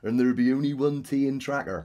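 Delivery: put-on broad West Country yokel accent. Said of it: "from the West Country".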